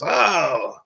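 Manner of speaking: over-the-top rogue greeting, high swoops on the hellos; terrible Hondo impression. Shown as a demonstration. Shady merchant welcoming everybody to drink and do business, over-the-top. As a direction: play a flamboyant, accented rogue welcoming friends. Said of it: "that's a terrible, terrible impression of this character".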